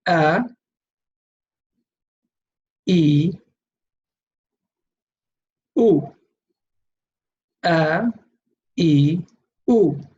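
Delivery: "a, e, u" as separate sustained vowels; three vowel sounds. Shown as a demonstration. Teacher leading students through vowel sounds, isolated "a, e, u". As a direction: sound out "a", "e" as ee, "u" as oo, one by one. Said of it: "pronounce the correct sound".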